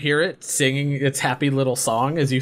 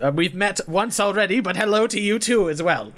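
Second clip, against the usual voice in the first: higher-pitched, eccentric gentleman; inventor Phineas; 7 semitones higher than usual. Acted. Inventor greeting monster hunters, higher formal character voice.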